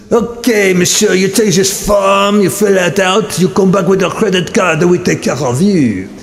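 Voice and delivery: comic French clerk taking a registration; mock French accent. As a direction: play a fussy French clerk, lightly.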